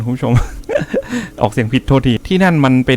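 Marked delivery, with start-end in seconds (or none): 0.4-1.4 soft, high giggle at own slip; high, quiet chuckle; embarrassed laugh. Real, not acted. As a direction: laugh off a botched pronunciation.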